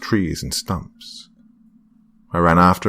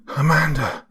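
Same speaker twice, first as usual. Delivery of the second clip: yell; 10 semitones higher than usual. A narrator's reading. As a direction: yell her name while running. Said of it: "I yelled".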